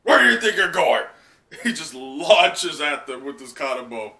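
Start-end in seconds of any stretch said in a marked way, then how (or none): 0.0-1.0 much louder, higher bellow; Kaido's roar. Acted. Kaido charging at Yamato and Momo, roaring. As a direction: roar it as he attacks. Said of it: "Kaido looking over at him".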